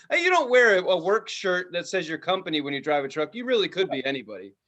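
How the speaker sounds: ordinary talk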